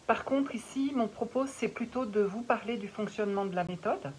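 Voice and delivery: ordinary talk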